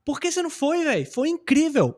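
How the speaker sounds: high-pitched "Por que você não foi" with a lifted "foi"; same intonation, Portuguese. Shown as a demonstration. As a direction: keep "incrível" high, then let it fall. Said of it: "A mesma entonação".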